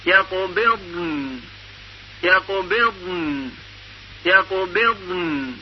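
یقبضن drawn out slowly, ending on a soft ن; slow careful recitation. Shown as a demonstration. Teacher models the ض before sakin nun. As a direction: say یقبضن slowly with great care. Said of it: "با دقت خیلی زیاد".

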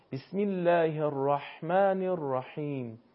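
slow recitation, ح in الرحمن made with a tightened throat; drawn-out demonstration of throaty ح in الرحیم; constricted ḥā. Shown as a demonstration. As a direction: squeeze the air through the throat on ح in الرحمن الرحیم. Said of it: "با گرفتگی در حلق".

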